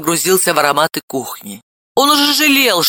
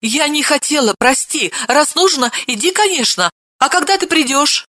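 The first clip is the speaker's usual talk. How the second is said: much higher timid apology; frightened babble; 9 semitones higher than usual. A narrator's reading. Scared wife apologising to husband, timid high babble. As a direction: murmur it timidly. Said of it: "испуганно залепетала".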